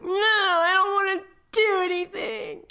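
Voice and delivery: put-on high, whiny six-year-old; whiny child. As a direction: whine it like a sulky kid. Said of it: "I turn into a six-year-old when I'm sick".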